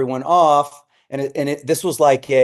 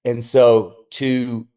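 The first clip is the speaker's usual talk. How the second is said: ordinary delivery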